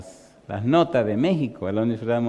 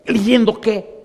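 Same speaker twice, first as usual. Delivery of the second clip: ¿leyendo qué? agudo y fuerte; asombro incrédulo; 9 semitones higher, 6 dB louder, slower than usual. Retold or imitated. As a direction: suelta la pregunta con sorpresa. Compañero incrédulo. (incredulidad de compañero, aguda y fuerte)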